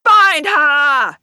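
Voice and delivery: loud, high barked order; shout. Acted. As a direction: shout the command at the posse. Gang chases the captain.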